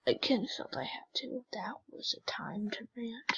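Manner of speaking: unvoiced whisper aside. Real, not acted. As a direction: breathe it as a sheepish confession. Whispered aside after ranting.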